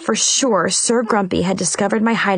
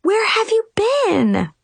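gawking; 11 semitones higher, slower than usual. A narrator's reading. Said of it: "I gawked at her".

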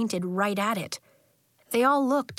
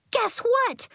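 excited yell. A narrator's reading. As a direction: call out high like an eager kid. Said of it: "Nori yelled".

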